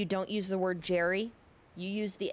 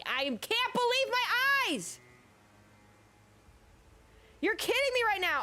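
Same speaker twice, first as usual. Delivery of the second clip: high-pitched exclamation of disbelief. Real, not acted. Exclaiming in disbelief mid-game.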